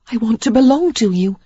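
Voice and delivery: Sarah, pleading. A narrator's reading. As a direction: plead to belong to him, voice lifted. Sarah's voice voiced by reader.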